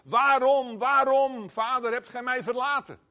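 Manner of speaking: loud, high cry of forsakenness; anguished cry. Acted. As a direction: call out waarom, waarom as from the cross. Jesus crying out on the cross. (luide, hoge, gekwelde uitroep)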